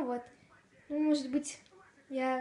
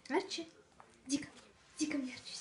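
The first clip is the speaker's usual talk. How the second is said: talking to a dog; 2 semitones higher, 4 dB quieter, much faster than usual. Real, not acted. Calling puppy to come.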